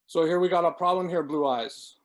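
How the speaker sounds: put-on loud, fast and cool, a Mexican gangster accent with a tweaker edge; loud cool gangster drawl. A narrator's reading. Gordon confronting Blue Eyes in the bar, loud cool Mexican gangster accent. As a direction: menace them coolly in a hybrid Mexican gangster accent. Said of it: "loud yet cool in his own hybrid accent".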